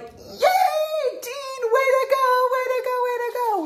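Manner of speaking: put-on very high, loud cheer for the toddler; excited cheering. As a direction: cheer him on like he just won. Family cheering toddler down stairs.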